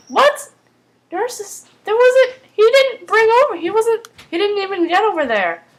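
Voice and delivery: high-pitched startled disbelief; real bewilderment. Real, not acted. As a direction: question it in baffled protest. Startled by the recap she reads.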